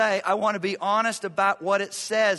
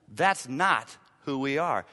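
usual speaking voice

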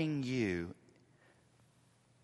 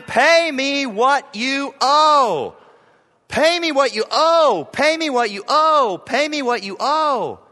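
loud, high, furious repeated demand; furious creditor shouting at his fellow servant; angry demand; 11 semitones higher, 11 dB louder than usual. Acted. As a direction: shout it like seizing his throat. Servant demanding debt, acted.

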